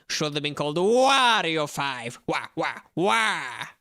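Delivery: slow, loud, gruff villain growl; Wario voice. Pretend. Streamer tries Wario for chat, slow and loud. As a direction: growl it out like a greedy brute. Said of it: "I mean, I can try".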